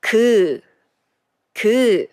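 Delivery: short clear 그 repeated; teacher modeling 그; model syllable. Shown as a demonstration. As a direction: say 그 for the class to echo. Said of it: "Repeat after me".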